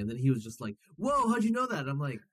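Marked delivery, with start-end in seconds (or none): 1.0-1.8 high, stunned mock gasp of wonder; astonished reply. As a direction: play the amazed stranger, pitch jumping up. Retells stunned man's reply.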